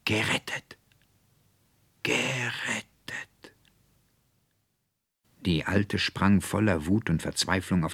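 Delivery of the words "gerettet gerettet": slow, breathy call from far away; a spirit voice calling out in relief; ethereal whispered call. A narrator's reading. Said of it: "Serpentinas Stimme rief". (gehauchter, ferner Ruf, langsam)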